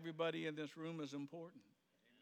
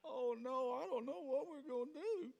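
mock panic; 8 semitones higher than usual. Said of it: "And what did Abraham reply?".

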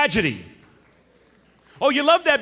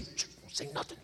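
whisper; 19 dB quieter than usual. Retold or imitated. Kid hushing brother before father.

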